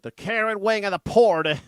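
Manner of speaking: loud, scornful echo of the phrase; mocking repeat.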